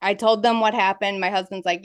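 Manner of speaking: ordinary talk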